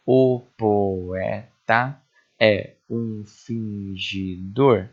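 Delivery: very slow, every syllable separated. Shown as a demonstration. Teacher counting grammatical syllables of the verse, syllable by syllable. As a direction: chop the verse into single syllables with pauses. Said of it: "começar pelas gramaticais".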